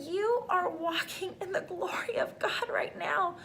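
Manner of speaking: tearful, voice breaking into a whisper; choked-up whisper. Real, not acted. Telling viewers about her vision, in a real choked-up whisper. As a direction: let the words catch in your throat. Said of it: "I'm just getting choked up right now".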